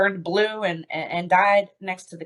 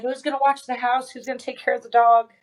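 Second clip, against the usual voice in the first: higher, panicky retelling of her own protest; shocked panic; 6 semitones higher than usual. Retold or imitated. Wife in shock, refusing to leave, asks in high panic. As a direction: replay the frantic questions of someone in shock. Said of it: "I was in shock".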